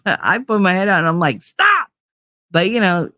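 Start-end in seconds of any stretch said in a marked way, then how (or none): 1.4-1.9 high-pitched call of a mom shouting; sharp shout. Retold or imitated. Mom calling out to stop the cars, high shout. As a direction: shout it out high, cutting it short.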